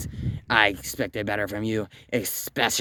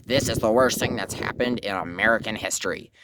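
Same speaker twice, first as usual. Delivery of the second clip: ordinary delivery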